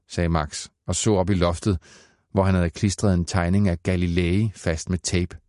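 plain reading voice

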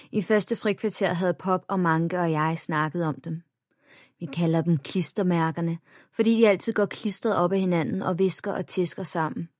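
ordinary delivery

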